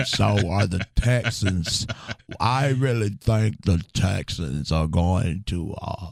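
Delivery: slow, drawn-out Texas rapper talk. Shown as a demonstration. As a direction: stretch every word out like a syrup-slowed rapper. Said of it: "talking like [name]".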